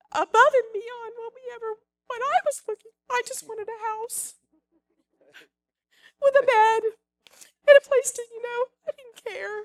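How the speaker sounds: high, strained, overwhelmed with emotion; choked up. Real, not acted. Testifying to church how God provided, choked up. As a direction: push the words out through rising emotion.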